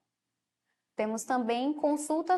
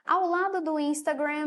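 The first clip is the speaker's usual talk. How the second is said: normal talking voice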